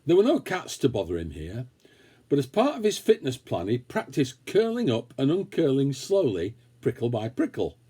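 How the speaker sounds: ordinary delivery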